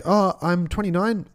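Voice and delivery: put-on high girly voice; girlish reply. Imitating the club girl telling her job, high and girlish. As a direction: chirp it like the girl he met.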